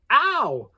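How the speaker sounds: sudden high loud ow; sharp cry of pain, high and loud; pained yelp. Acted. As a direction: burnt fingers, yelp it out. Pick catches fire in hand.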